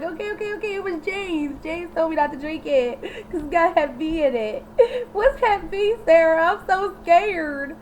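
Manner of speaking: high, squeaky scared babbling; mock panic. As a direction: play a cowering snitch, voice up high. Steve snitching in panic.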